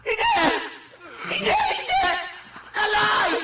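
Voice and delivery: very high frantic cries; panicked calling. Acted. Wife screaming as husband collapses, terrified calls. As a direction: scream his name in terror.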